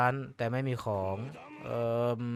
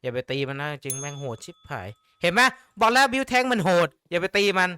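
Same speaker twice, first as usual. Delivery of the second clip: louder, urgent don't hit it; loud alarmed call not to attack; loud warning; 3 semitones higher, 7 dB louder than usual. Real, not acted. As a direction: yell the warning at the screen. Warning about dangerous creature.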